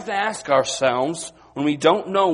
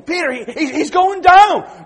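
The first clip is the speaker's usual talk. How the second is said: alarmed cry; 13 semitones higher, 11 dB louder than usual. Retold or imitated. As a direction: sound the alarm as he sinks. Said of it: "But they're saying".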